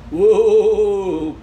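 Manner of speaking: loud, long spooky wail; wailing cave. A narrator's reading. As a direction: howl it out like a haunted cave. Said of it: "cried the deep, dark, mysterious cave".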